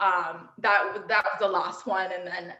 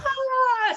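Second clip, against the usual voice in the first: high, loud, drawn-out "ah" scream; imitated crowd cheer; 5 dB louder than usual. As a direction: mimic a crowd going wild. Imitates crowd roaring at backflip.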